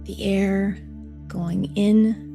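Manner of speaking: soft, slow meditation voice; guided meditation. Acted. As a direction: guide them gently into calm, unhurried. Leads a heart meditation.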